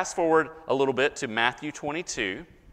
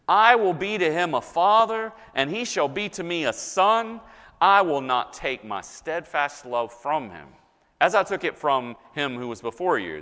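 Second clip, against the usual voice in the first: emphatic scripture reading; 3 semitones higher, 5 dB louder than usual. Acted. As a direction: proclaim the promise phrase by phrase, with pauses. Reads God's promise aloud from scripture.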